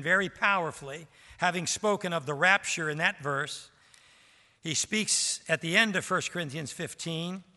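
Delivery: ordinary talk, nothing marked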